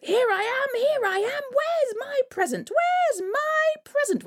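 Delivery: put-on very high eager child voice; excited little boy. Butterball jumps out for his present, very high and eager. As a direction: bounce with excitement.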